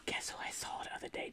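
hushed gossip whisper. Real, not acted. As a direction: lean in and whisper it. Gossip whispered, secretive aside.

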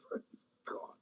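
breathy hushed praise; whispered praise. Real, not acted. Preacher catching his breath after the peak, whispering praise. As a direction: breathe it out, awed.

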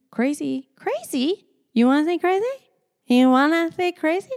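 high, incredulous repeat of crazy; offended. Real, not acted. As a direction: throw the word crazy back at them. Offended at being called crazy.

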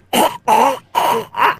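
acted choking gags; hammed-up dying choke; pretend choking. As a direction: gag repeatedly as if poisoned. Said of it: "while going like".

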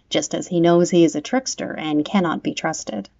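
plain reading voice

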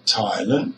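Thailand with a strong, long THAI; "Thailand": stressed THAI, short weak second syllable; stress on first syllable. Shown as a demonstration. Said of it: "is a strong syllable".

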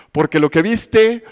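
normal talking voice

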